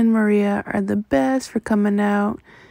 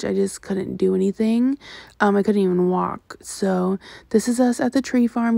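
ordinary delivery

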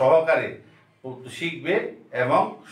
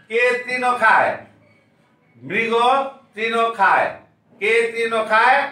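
classroom call and response; 5 semitones higher, slower than usual. Acted. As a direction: call out each question and answer for pupils to echo. Class recites along with teacher.

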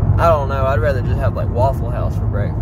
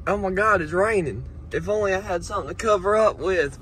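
mocking helpless woman; 7 semitones higher, 5 dB quieter than usual. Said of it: "this bitch is like".

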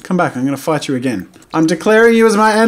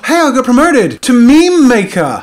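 raised pitch, pleasantly startled; excited announcement; 6 semitones higher, 4 dB louder than usual. Real, not acted. Gamer learns of the promotion to meme maker, excited announcement. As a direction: announce good news with a lift.

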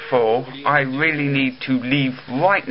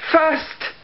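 "First" squeaked much higher, mocking; mock the commenter's "First"; 13 semitones higher than usual.

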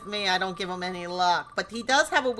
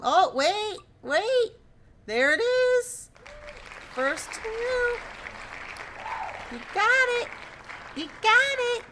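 much higher, rising thrilled squeals; real delight, pitched way up; excited squeal. Real, not acted. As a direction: squeal as the wheel lands. Wheel lands on a hit.

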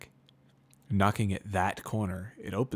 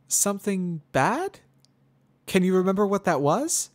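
put-on much higher, louder young mare, forced friendly; Twilight Sparkle; 11 semitones higher, 8 dB louder than usual. A narrator's reading.

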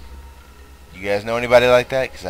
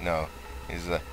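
ordinary delivery

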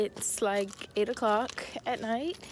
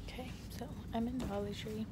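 hushed voice, much softer; quiet; 9 dB quieter, faster than usual. Real, not acted. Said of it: "I'm not gonna talk loud".

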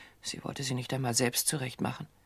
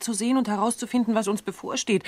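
building higher and louder, slowing on the last phrase; agitated, higher and higher; rising agitation; 6 semitones higher, 6 dB louder than usual. Acted. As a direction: let the fear climb to the end. Agitated, fearing for herself.

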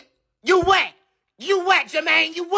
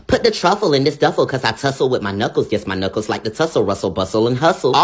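put-on louder, bouncy rhyming kids'-book patter; Dr. Seuss rhyme; 7 semitones lower, 4 dB louder than usual. Robber rehearsing a threat to his partner, rhyming like Dr. Seuss. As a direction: rattle it off like a nursery rhyme. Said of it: "Okay, Doctor Seuss".

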